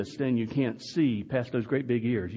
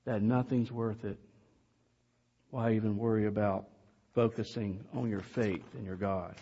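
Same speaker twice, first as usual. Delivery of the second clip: normal talking voice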